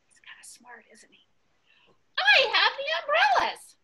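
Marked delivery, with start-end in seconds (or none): 0.1-1.3 hushed whisper, much softer; whispered aside. Acted. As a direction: share a secret with the kids.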